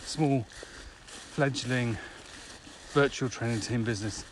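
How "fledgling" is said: ordinary talk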